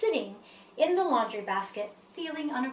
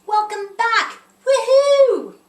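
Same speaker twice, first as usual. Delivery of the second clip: loud, much higher joyful whoop; cheering; 10 semitones higher, 14 dB louder, slower than usual. Acted. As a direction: cheer his return at the top of your voice. Numbers celebrate number three returning.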